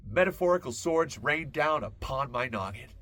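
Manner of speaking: ordinary delivery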